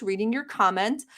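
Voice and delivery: ordinary talk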